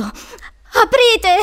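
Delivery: high, loud cry to open up; panicked yell. Acted. Trapped boy shouting at a locked door, panicked yell. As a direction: yell to be let out. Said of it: "urlò".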